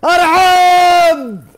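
long, very high, loud stretched cry; drawn-out welcome shout. Real, not acted. Streamer greeting a big gifter, stretched high shout. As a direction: belt out the welcome long and slow.

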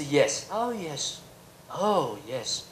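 slow, soft, drawn-out yes yes of a polite Brit; polite Englishman. Retold or imitated. Lecturer mimicking an English host humouring him, slow soft yeses. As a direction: mimic the endless polite nodding, slowly.

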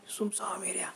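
near-whisper, spooky storytelling; hushed eerie aside. A narrator's reading. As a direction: drop to a whisper for the empty area. निर्जन जागेचे गूढ वर्णन. (कुजबुजत गूढ स्वरात)

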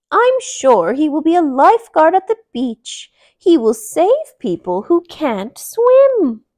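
high, loud fussy aunt. Acted. Aunt bunny disagreeing about the lifeguard, high and loud.

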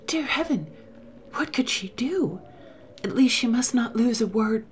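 high, frantic inner cry; breathless terror rising; a touch of frightened prayer; panic. A narrator's reading. Anne's panic in narration.

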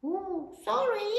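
high, slow little apology; apologetic little kid goat. A narrator's reading. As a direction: say sorry as a tiny goat.

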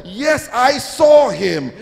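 high, drawn-out insistent call. Acted. Another woman accusing Peter, calling out. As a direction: call it out as an eager eyewitness. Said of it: "another woman called".

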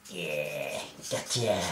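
low, soft villain mutter with a snicker; mock-villain cat voice; gloating cartoon cat. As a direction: savour the catch like a sly cat. Voices cat atop the lampshade.

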